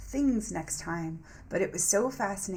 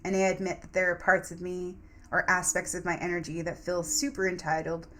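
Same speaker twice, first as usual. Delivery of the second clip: ordinary talk, nothing marked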